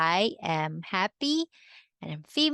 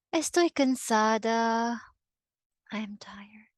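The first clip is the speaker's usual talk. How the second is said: tired whisper; 3 semitones higher than usual. Shown as a demonstration. Teacher acts tired phrase.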